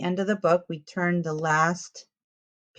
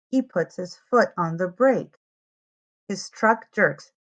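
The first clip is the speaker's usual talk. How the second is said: lively picture-book read-aloud; reading to children. A narrator's reading. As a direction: tell it to little ones, clearly and brightly.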